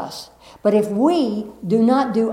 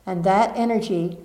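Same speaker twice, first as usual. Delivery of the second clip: guiding a seated group through the flow; guided meditation; 2 semitones lower, 4 dB quieter than usual. Acted. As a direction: lead them gently through the energy. Leads energy meditation.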